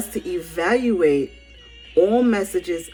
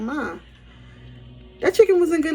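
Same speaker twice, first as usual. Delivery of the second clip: put-on complaining kid; 9 semitones higher than usual. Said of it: "turn to our mother and be like".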